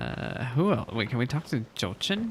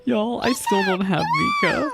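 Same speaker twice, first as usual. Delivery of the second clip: laughing complaint; 4 dB louder than usual. Real, not acted. Streamer laughing complaint, very high.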